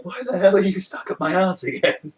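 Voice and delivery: high-pitched indignant complaint, played for laughs; comically aggrieved high voice; mock outrage. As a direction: imitate an exasperated victim, pitched well up. Voices outraged Gintoki in a retelling.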